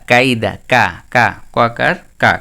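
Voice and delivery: ordinary delivery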